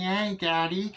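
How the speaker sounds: put-on high little-kid cheer; toddler voice. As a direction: squeal it like a happy toddler. Voicing toddler Gage.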